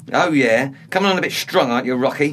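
loud, rising taunt; aggressive challenge. Acted. Younger man goading his older housemate, aggressive challenge. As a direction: goad him into a fight.

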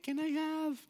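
put-on very high, squeaky little-kid voice; little five-year-old brother. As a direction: beg like a small child at a drive-through. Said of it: "you know, five".

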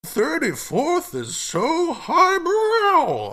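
very high, slow, drawn-out snob; loud, hammed-up society shriek; bourgeois impression. Podcaster playing the family sneering at an address, exaggerated bourgeois snob. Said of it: "Bourgeois Impression".

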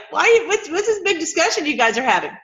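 high-pitched, curious questioning; casual Jesus voice. Retold or imitated. Retelling Jesus questioning the travellers, casual high voice. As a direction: ask it high and informal. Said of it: "he's like".

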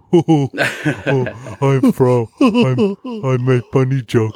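mock simple-minded caveman talk; dopey caveman voice. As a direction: dumb it down, broken-English mockery. Mocking a friend's persona.

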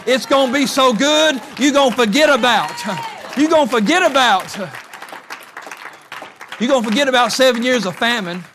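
loud, high, rousing sermon voice; preaching at full force. Acted. Preacher urging the congregation to go back, loud and rousing.

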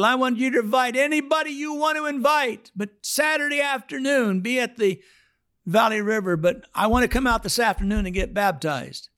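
mock high Fred voice; high-voiced Fred. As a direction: chirp it up high like the old man. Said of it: "now he doesn't talk like this".

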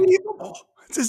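squeaky high burst of fake awe; hammed-up childlike disbelief; mock amazement. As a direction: overplay the astonishment. Joining the kid's wonder bit.

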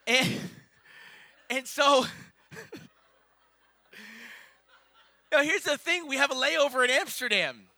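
pushing up to the big reveal; building excitement. Real, not acted. Building to the punchline.

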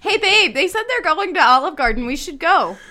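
high, loud mock enthusiasm; hammed-up chummy excitement, breaking into laughter; over-friendly excitement. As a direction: announce it like thrilled long-lost friends. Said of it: "Act as if they are our long-lost best friends".

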